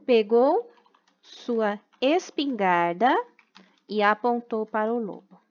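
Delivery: put-on slow, even reading for children to write down; slow dictation. Teacher dictating a rewritten story to the class, slow and deliberate. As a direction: read it out word by word so the class can copy it.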